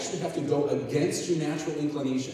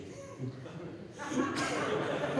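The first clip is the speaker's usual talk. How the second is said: high, breathy burst of laughter; real burst of laughter; 11 semitones higher, 7 dB quieter than usual. Real, not acted. Preacher cracking up at his own story, laughing.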